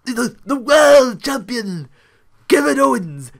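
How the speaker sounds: high, loud, drawn-out announcing; Booker T impression. Imitating the commentator's title call, high and loud. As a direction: belt it out slowly in a high commentator voice. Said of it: "he does, like".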